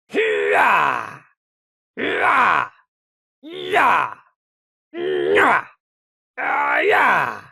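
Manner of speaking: high shouted cheers; battle whoops. Acted. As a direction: whoop after the kill. Soldier's victory whoops.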